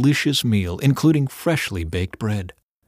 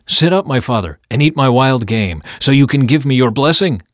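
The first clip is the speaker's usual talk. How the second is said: louder, urging his father on; keen, forceful invitation; eager son; 3 semitones higher, 7 dB louder than usual. A narrator's reading. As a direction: eagerly offer it up, voice raised.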